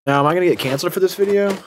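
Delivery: mock British accent, quick and lifted. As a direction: put on a posh British accent for the camera. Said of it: "you can't cancel me for doing a British accent".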